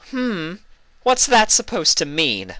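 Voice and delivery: high indignant Luna question. Acted. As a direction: demand it with offended impatience.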